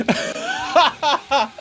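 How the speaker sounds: laughing. Real, not acted. Laughing burst.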